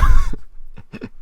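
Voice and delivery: sudden high laugh; burst of laughter. Real, not acted. High laugh at her own joke about Glenn.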